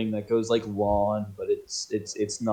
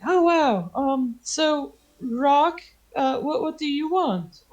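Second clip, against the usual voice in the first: very high, hesitant gnome; tiny gnome feeling out a customer; high-pitched, puzzled little gnome; gnomish voice; slower than usual. Acted. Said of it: "he looks at you and he's like".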